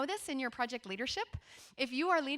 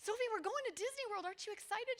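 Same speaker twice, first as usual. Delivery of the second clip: higher, upbeat parent prompting; coaxing parent; 10 semitones higher, 4 dB quieter than usual. Retold or imitated. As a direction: cheer her up like a hopeful mom.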